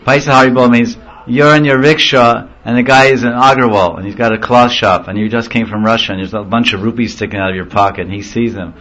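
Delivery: ordinary talk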